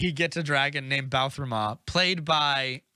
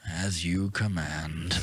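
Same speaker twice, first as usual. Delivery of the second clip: very low, slow, solemn dragon voice; obedient dragon; 8 semitones lower, much slower than usual. Retold or imitated. Dragon answering his young master, solemnly obedient. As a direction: intone it like an ancient servant beast.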